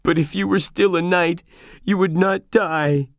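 mild sob, pitch lifted and slowing; sobbing. Acted. Sancho begging his dying master, tearful. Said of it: "Sancho sobbed".

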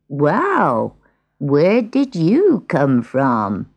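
slow, drawn-out surprise; lightly voiced old woodcutter. Old woodcutter finds a stray cat, lightly voiced, slow. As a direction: take your time, as a puzzled old man.

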